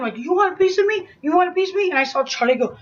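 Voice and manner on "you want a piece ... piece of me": higher-pitched mock fighting challenge; crazed challenge. Attacker challenging the friend who stepped in, crazed. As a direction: imitate someone going crazy, pitched up. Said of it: "she went crazy on him".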